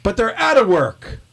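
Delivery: high-pitched comic bafflement; exasperated protest. Acted. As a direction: protest to your straight man, pitch jumping up. Acted baffled protest in comedy routine.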